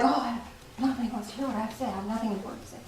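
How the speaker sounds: low, soft, hurried self-talk; dejected. Acted. Feeling nobody wants to listen, dejected. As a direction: sink into a quick, defeated murmur.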